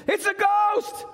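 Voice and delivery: high panicked yell of fright; terrified shout. Retold or imitated. Disciples in the boat crying out in terror. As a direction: scream it like seeing a ghost. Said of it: "They say".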